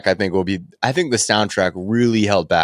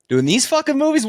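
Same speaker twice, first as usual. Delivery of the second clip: exasperated outburst. Real, not acted. Ranting about wasted director career.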